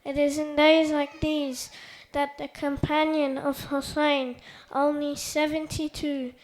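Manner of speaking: loud, forceful oratory; formal speech. Acted. Speaker honoring the Prophet's family before the gathering, loud declamation. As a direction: project a solemn speech to the whole hall. Delivers prepared speech after quiet introduction.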